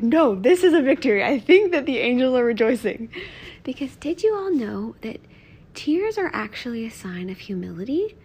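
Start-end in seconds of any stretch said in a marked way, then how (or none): 0.0-3.1 excited and much louder, a little higher; retold joyful reply. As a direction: proclaim it as a victory, loudly. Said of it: "And I'm like".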